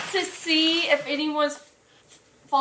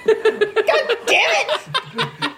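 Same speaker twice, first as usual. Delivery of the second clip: laughing protest; 8 semitones higher, much slower than usual. Real, not acted. Being tickled, laughing protest.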